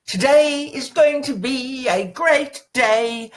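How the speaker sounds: high, slow, stretched-out cheer; playful drawn-out declaration. Shown as a demonstration. Coach entertaining yourself each morning, playfully drawn out and high. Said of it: "I don't care how you say it".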